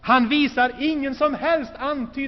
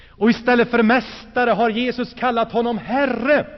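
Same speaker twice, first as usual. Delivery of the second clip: ordinary talk, nothing marked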